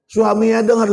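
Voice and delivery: ordinary delivery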